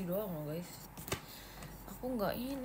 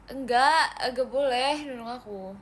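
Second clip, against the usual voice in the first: drawn out; 3 semitones higher, 7 dB louder, slower than usual. Real, not acted. Drawn out protest.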